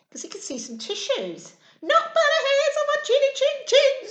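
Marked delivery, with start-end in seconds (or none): 1.8-4.1 high, loud squeaky pig voice; squeaky little pigs. Acted. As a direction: squeak it like frightened piglets. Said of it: "squeaked the little pigs".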